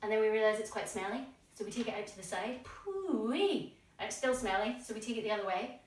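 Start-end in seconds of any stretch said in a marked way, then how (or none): 2.6-3.7 mock disgust. Foot is smelly.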